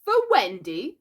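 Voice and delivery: aghast. A narrator's reading. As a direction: ask it aghast.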